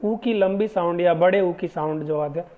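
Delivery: usual speaking voice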